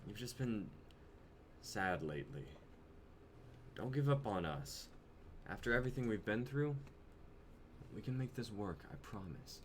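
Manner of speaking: soft, low and gentle; tender reassurance. Acted. Jonah reassuring his sad girlfriend, tender and quiet. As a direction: hold her with your voice.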